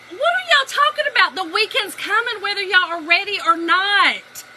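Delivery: high, loud mock-baffled husband; exasperated outburst. Baffled husband cuts into weekend small talk, loud exasperation. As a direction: protest loudly, drawing out the end.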